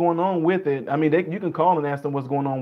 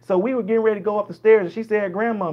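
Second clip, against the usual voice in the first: higher-pitched rambling Southern storyteller; slow-to-the-point Southern dialect; 5 semitones higher than usual. Acted. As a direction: ramble sociably in a Southern accent, in no hurry. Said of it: "so they'll go all the way around".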